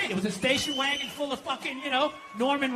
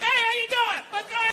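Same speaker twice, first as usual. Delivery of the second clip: higher, cheerful mock-innocent greeting; breezy dad; 10 semitones higher than usual. Dad greeting the priest at mass a week later, breezy. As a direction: breeze in like nothing happened. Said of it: "my dad just comes walking like".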